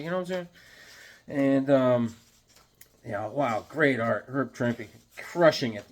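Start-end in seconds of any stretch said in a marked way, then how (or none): none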